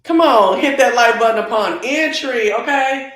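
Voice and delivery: calls out loud to the viewers. Real, not acted. Hyping viewers to hit like, loud. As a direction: belt the request to the chat.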